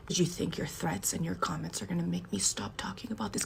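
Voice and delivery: hushed, voiceless whisper; conspiratorial whisper to the viewers. Real, not acted. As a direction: lean in and whisper it. Lecturing viewers in a hushed aside.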